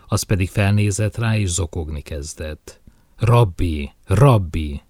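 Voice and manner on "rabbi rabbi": louder, narrated cry of grief; understated sobbing appeal; sobbed plea. A narrator's reading. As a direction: call out the name as if through tears, lightly acted.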